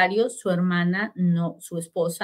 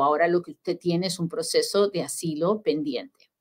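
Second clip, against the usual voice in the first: normal talking voice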